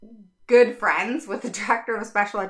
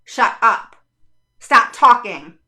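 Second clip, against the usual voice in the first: louder mock command, slow on stop talking; imitating a harsh order; 9 dB louder, slower than usual. School staff silencing a parent in meetings, loud mock order. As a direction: bark it like a school official. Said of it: "guess what they say".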